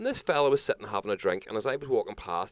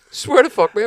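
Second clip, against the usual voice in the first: high, loud laughing swear; laughing oath; 14 dB louder than usual. Real, not acted. Telling his mate, laughing loudly and insisting. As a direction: swear it through a burst of laughter.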